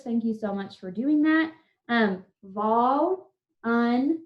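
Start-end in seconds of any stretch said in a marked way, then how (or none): 2.4-4.2 volunteer very slowly, split into vol, un, teer; three separated beats on volunteer; syllable by syllable. Shown as a demonstration. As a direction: pause between each syllable of volunteer. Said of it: "Three syllables".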